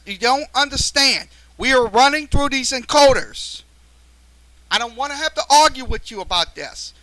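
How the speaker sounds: really upset, scolding the viewer Fox; live host snapping at a viewer over the audio; angry. Real, not acted. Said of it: "I'm getting very upset at, at a viewer".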